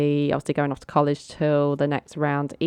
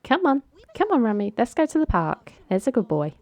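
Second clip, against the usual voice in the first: high-pitched pretend pet-owner voice; imitated doggy talk, high and eager; coaxing a dog; 7 semitones higher than usual. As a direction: playfully call the dog along, pitched well up.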